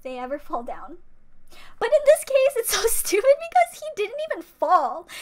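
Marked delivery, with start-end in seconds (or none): 1.8-5.1 loud, much higher, exasperated protest; indignant outburst. Real, not acted. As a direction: burst out in real exasperation, pitch soaring. Indignant outburst defending the idol.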